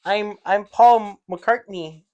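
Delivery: put-on botched British accent that sounds Canadian; bad British accent. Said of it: "that's what Canadian trying to do a British sounds like".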